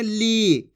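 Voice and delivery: ली with a long held ee; drawn-out vowel. Shown as a demonstration. Teacher shows how ला is pronounced, ली drawn out long. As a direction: stretch the ई in ली. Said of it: "खींच के करेंगे".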